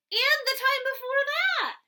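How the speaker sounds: exasperated big brother, jumping up in pitch; exasperated protest. Acted. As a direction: squeak it out in disbelief. Charlie complains the book's overdue.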